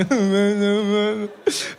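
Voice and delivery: hammed-up wailing sob; mock crying. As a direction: bawl like a big baby, put on. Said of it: "So I'm crying for everything".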